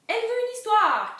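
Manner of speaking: very loud, high-pitched shout; cri collectif. A narrator's reading. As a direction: cry it out at full voice. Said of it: "crièrent-ils". (cri très fort et aigu)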